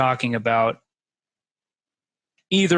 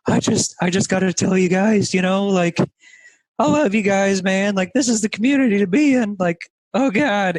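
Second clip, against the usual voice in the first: higher-pitched mock-emotional testimonial; imitated tearful bawling; 6 semitones higher, 3 dB louder than usual. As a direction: gush like you're choking up, exaggerated. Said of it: "just bawling his eyes out".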